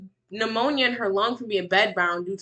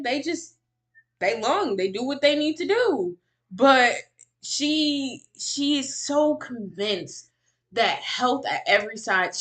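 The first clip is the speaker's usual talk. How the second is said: ordinary delivery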